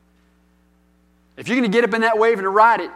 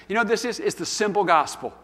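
usual speaking voice

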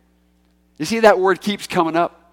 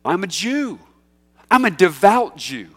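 voicing Paul; 5 semitones higher, 5 dB louder than usual. Preacher voices Paul's defense.

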